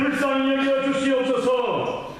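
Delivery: high, drawn-out chanting proclamation; impassioned prayer call, pitched high and sustained; crying out in faith. Shown as a demonstration. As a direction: cry out a fervent confession to Jesus. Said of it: "어떤 신앙 고백으로 외쳐야 됩니까".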